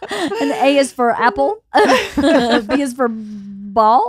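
slow, drawn-out picture-book reading with a laugh; mock children's book reading. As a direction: read it like a toddler's alphabet book, slowly. Joking about reading a picture book.

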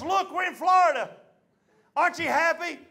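high, upbeat imitation of mama; cheering up. Mama trying to make it all better, cheering up. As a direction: act mama's eager cheer, pitched high. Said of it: "tried to make it all better".

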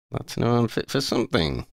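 usual speaking voice

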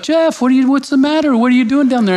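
surprised friends. Retold or imitated. Friends wake up, find him down.

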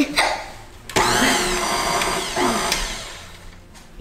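laughing. Real, not acted. Icing mixing goes wrong.

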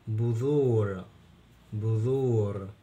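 بذور with a drawn-out oo after the ذ; long waw. Shown as a demonstration. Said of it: "بالواو والممدود الذال". (مدّ الواو بعد الذال في بذور)

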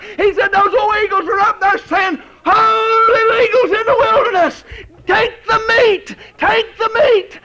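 shouted, high-pitched preaching climax; preaching at full force. Acted. Preacher shouting to the congregation, fervent full-force preaching. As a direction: cry it out to the congregation.

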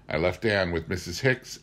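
usual speaking voice

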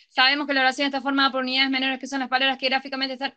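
fast monotone run-on; racing through the sentence on an even pitch; rushed without pauses. Shown as a demonstration. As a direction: rattle it all off in one breath, no pauses. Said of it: "No es que decimos".

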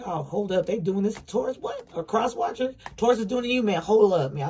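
hammed-up, high-pitched disbelief; mock indignant outrage. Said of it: "I got pissed right there for a second".

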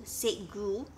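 ordinary delivery

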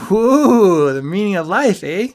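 high, loud hooting mockery; mocking awe. A narrator's reading. Friend teasing him about life's meaning, mock-impressed hoot. Ski mocks friend's grand claim.

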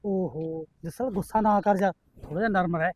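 soft calming words to a hot-headed son; soothing. Acted. Father calms angry son. (ਨਰਮ, ਸਮਝਾਉਂਦੀ ਆਵਾਜ਼)

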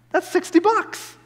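high-pitched astonished exclamation; amazed. Retold or imitated. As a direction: gape at the amount saved. Amazed at free ticket's value.

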